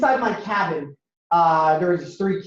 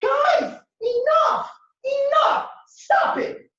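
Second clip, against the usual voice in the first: very high-pitched, pleading yell; breaking up a fight; much slower than usual. Acted. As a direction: shriek at them to stop, one burst at a time. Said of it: "So I get in the middle".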